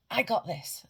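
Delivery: loud falling shout of I got this; loud affirmation. Shown as a demonstration. Coach showing viewers the confidence shout, loud. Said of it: "as loud as you can".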